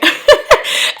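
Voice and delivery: high airy burst of laughter; real amused laugh; high breathy laugh. Real, not acted. Laughing at the ringtone.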